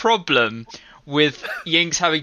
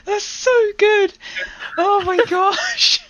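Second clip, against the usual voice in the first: high, laughing almost to tears; helpless laughter. Real, not acted. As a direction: squeal it through laughter. Cracking up at the screenshot.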